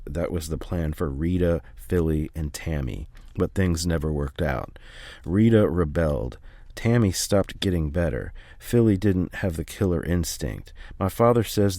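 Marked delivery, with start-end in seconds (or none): none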